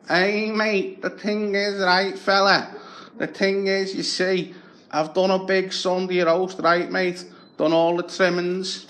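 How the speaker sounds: high, chummy wheedling Scouse beggar; scrounger's voice. Retold or imitated. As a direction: sweet-talk the neighbour for a favour. Beggar Tony voiced at door.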